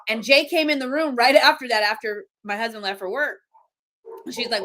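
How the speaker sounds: ordinary talk, nothing marked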